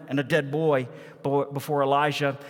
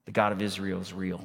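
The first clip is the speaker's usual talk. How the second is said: "the God of Israel is real" said softly and fast; quiet restatement; 8 semitones lower, 5 dB quieter, faster than usual. Shown as a demonstration. Preacher softly restating the mother's realization. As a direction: murmur "God" as a calm summary. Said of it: "In other words".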